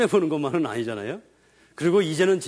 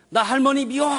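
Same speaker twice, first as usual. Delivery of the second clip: put-on high, louder bratty child yelling; child's outburst; 8 semitones higher, 3 dB louder than usual. Child complaining to mother about grandma, whiny shout. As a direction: throw a child's tantrum.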